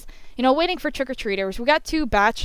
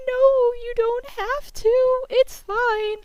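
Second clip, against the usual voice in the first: polite refusal; 13 semitones higher, slower than usual. Retold or imitated. Declining the manager, voiced quote.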